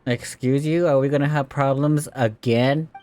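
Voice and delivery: ordinary delivery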